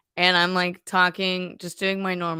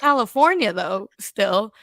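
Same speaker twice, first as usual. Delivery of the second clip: sassy retort; 3 dB louder than usual. Retold or imitated. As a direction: throw it back with sass. Sassy reply continues.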